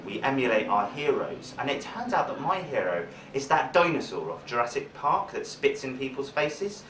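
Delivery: ordinary talk, nothing marked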